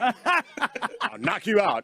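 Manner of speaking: slow, high, loud tough guy threat; Detroit tough guy. Acted. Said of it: "Detroit tough guy voice".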